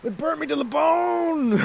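high, slow hammed-up yelp of pain; mock pained cry. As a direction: ham up a man burning his hands. Mock scalded soup eater.

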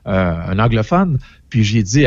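normal talking voice

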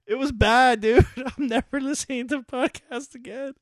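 high, exasperated through laughter; laughing. Real, not acted. Host condemning the podcast to co-host, high and laughing. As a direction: swear off it while cracking up.

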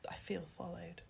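whisper. Real, not acted. Joking aside about rival podcast.

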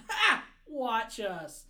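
loud, high taunting laugh; mocking laugh. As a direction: cackle it out, gloating. Writers mocking fans.

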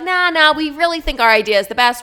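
high, brushing off the idea; dismissive brand rep. Retold or imitated. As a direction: wave the suggestion away. Brand rejects creator's idea.

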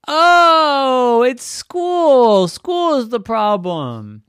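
high, loud eureka cry; sudden realization. Acted. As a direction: blurt it out as it dawns on you. Daddy realizes loudly.

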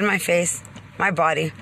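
ordinary talk, nothing marked

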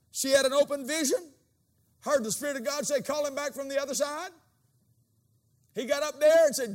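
fiery pulpit preaching; full-force preaching. Acted. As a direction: preach it out to the crowd.

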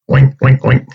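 a cheerful pig grunt; happy oink. Acted. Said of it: "She was in her happy place".

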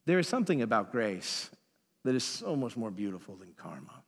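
ordinary delivery

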